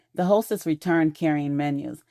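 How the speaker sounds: normal talking voice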